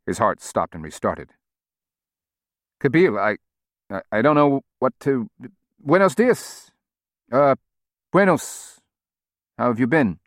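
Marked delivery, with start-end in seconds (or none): 2.8-10.2 nervous stammer. Acted. Norman stammers, heart stopped.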